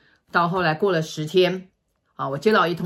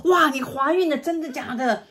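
high, thrilled gasp of delight; overjoyed cousin; 9 semitones higher than usual. Retold or imitated. Cousin thrilled to hear she's pregnant, excited high voice. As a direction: squeal the news with joy. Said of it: "非常高兴".

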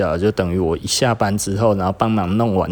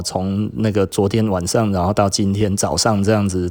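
ordinary talk, nothing marked

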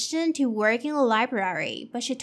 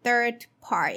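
usual speaking voice

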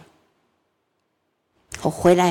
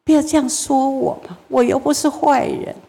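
pleading husband; 5 semitones higher than usual. Retold or imitated. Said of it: "先生就最后没有办法说".

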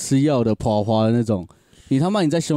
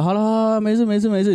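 smoothing it over; 9 semitones higher than usual. Retold or imitated. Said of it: "那老长就说".

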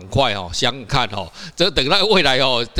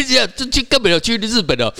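laughing; 11 semitones higher, 3 dB louder than usual. Real, not acted. Laughs about Taiwanese learning Taiwanese.

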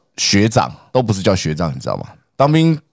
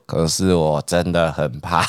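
slow, softer, half-laughing mock fear; slow dopey friend; 5 semitones lower, 3 dB quieter, slower than usual. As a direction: drag it out like a simple guy. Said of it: "就是讲话很慢".

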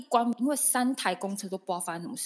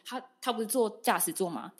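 normal talking voice

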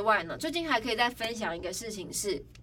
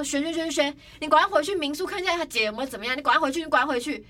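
fast, higher, louder pleading; re-enacted rapid-fire panic; nervous urgency; 5 semitones higher, 4 dB louder, faster than usual. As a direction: rush the orders out anxiously. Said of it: "我也很紧张".

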